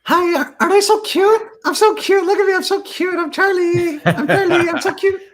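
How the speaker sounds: put-on very high squeaky baby voice for the dog; Caitlin's dog voice. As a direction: squeal it like a doting owner voicing her pet. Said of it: "Caitlin does. It goes something like".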